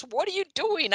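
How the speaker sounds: put-on high puzzled girlfriend voice. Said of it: "She goes".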